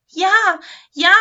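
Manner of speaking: high, loud, excited yes. A narrator's reading. Lametta agrees to help Santa, excitedly. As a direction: burst out with eager agreement. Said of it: "sagte Lametta aufgeregt".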